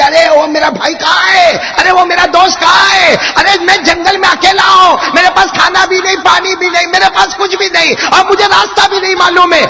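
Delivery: loud, high cries for lost friends; shouting names in panic, alone in the wilderness; desperate shouting. Acted. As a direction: call out into the empty desert, frantic. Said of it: "زور زور سے سب کو چلانے لگے".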